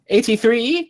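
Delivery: high-pitched little clerk calling out; high, questioning waiting-room call; little bespectacled man. Acted. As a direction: call the number in a high, put-on voice. Doorway man calls next ticket number.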